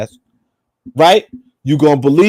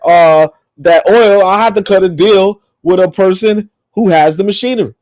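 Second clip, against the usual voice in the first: ordinary talk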